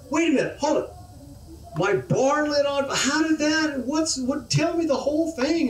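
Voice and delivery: high, rattled man's voice; a man whose world is falling apart, squeaking up high; alarmed man. Acted. The man reacts to bad news.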